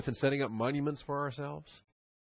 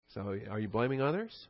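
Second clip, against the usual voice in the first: ordinary talk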